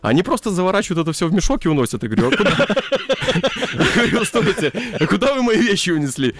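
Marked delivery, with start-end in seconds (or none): none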